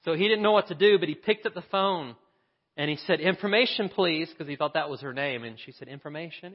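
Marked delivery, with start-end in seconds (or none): 3.2-4.3 put-on child calling out. Boy calls with hurt finger. 5.9-6.5 soft operator answer. Acted.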